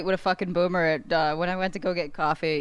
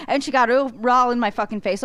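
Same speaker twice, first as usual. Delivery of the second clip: ordinary talk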